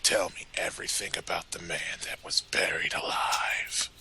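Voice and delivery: low, whispered menacing demand; soft, sinister whispering threat; Dorn. Acted. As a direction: interrogate in a hushed, threatening rasp. Continues Dorn's voice, interrogating.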